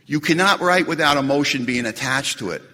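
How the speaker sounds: ordinary talk, nothing marked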